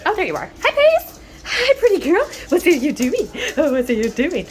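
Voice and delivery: high, loud sing-song pet voice. Real, not acted. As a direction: gush over the puppy in a high coo. Greeting her dog Paisley.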